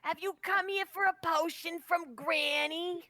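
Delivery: put-on old potion-selling hag voice; granny hag. Granny offering potions to a visitor, hag voice. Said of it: "like, a hag with that voice".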